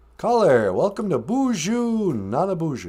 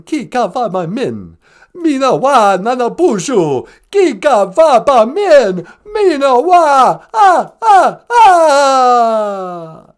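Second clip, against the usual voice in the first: loud vampire voice, rising to a hammed-up sob; loud, overacted goodbye in vampire voice; count repeats the phrase; 6 semitones higher, 8 dB louder, slower than usual. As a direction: repeat "Giga waba min, mina wa" in the count accent, then wail.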